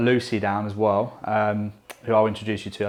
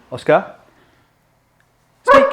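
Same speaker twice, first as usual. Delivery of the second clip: high-pitched call to the dog; dog command; 8 semitones higher, 8 dB louder, slower than usual. Real, not acted. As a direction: sing out the dog's name, then command.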